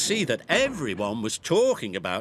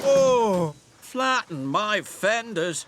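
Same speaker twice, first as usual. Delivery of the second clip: shouted dismay; 8 semitones higher, 4 dB louder, slower than usual. A narrator's reading. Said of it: "shouted Monty".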